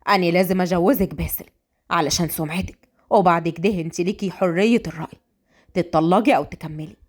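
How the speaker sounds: low, matter-of-fact elder; a father setting terms; father to daughter. Acted.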